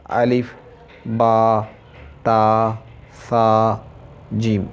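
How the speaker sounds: अलिफ बा ता सा जीम each named slowly with pauses; letters said separately. Shown as a demonstration. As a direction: say अलिफ, बा, ता one by one. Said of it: "जैसे कि हरफ होता है ना अलहदा".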